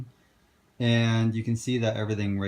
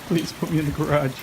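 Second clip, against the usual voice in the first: lightly voiced pleading dog; a hint of a whiny pet; pet's voice; 5 semitones higher, 4 dB louder than usual. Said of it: "He's like".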